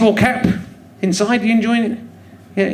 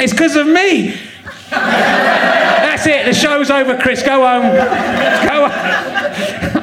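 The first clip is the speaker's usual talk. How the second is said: higher-pitched, crowing announcement; mock triumph; 5 semitones higher, much slower than usual. Host pretending to send the guest home, mock triumph. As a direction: crow it as if you've stolen the show.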